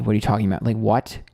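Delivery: normal talking voice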